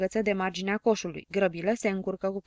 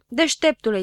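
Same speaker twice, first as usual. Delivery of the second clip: louder, higher scornful burst; angry outburst; 4 semitones higher, 7 dB louder than usual. A narrator's reading. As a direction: explode at him. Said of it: "izbucni Don Denel".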